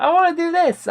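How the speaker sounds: put-on high, eager five-year-old; quoting his five-year-old self in a high little kid voice. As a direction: squeak it out like a small child. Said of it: "when I was five, I was like".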